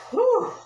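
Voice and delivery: breathless high whew; winded whew. Real, not acted. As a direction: blow out a tired whew. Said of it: "I am winded".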